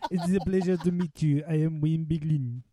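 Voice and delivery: put-on accent, meant as Welsh; mock Welsh accent. Wayne Biglin greets the other hosts, in a mock Welsh accent. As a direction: introduce yourself in a joke Welsh accent. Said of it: "is that your Welsh accent again".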